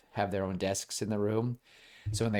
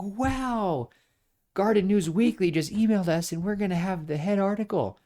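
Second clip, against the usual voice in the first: much higher, thrilled reading-out voice; excited dad; 11 semitones higher than usual. Retold or imitated. Dad reads the email to mom, much higher and thrilled. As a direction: gasp it out like the best news ever.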